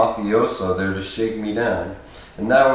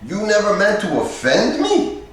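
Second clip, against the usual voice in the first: high, doubting echo ending in a rise; incredulous tough guy repeating the apology; disbelieving; 11 semitones higher than usual. A narrator's reading. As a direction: throw his words back as if they're a lie. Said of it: "in a tone that suggested he thought I was lying".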